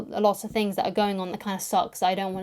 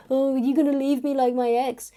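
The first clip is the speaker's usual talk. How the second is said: higher-pitched mock whine; whiny, insecure; 5 semitones higher than usual. Insecure new partner pleading not to be left, whiny. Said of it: "being insecure and being whiny".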